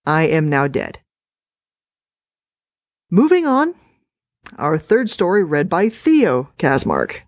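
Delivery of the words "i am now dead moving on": ordinary delivery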